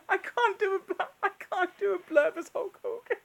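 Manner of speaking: real laughter through words; laughing. Real, not acted. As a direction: break up laughing. Laughing fit.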